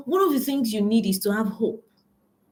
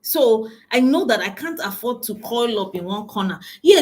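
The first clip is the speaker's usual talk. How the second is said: ordinary delivery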